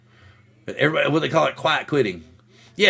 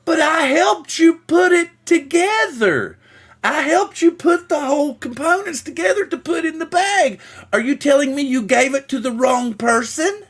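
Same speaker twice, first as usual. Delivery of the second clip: higher, slow, exasperated mock voice; incredulous manager; 11 semitones higher than usual. Manager confronting the worker about the lost order. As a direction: spell out her disbelief.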